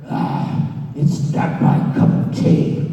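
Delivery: slow, rough, airy dismissal; gruff Vince. Retold or imitated. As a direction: drawl the refusal like a grumpy boss. [name] voices a dismissive Vince.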